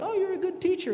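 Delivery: butter him up in a high sweet voice; flattering young man. Retold or imitated. Rich young man flattering Jesus, high and fawning. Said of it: "He's like".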